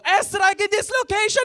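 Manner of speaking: huge high battlefield shout; shouted command. Pretend. Comic urge to shout at the man calling him a terrorist, full shout. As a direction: bellow it like calling in an airstrike. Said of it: "really wanted to go".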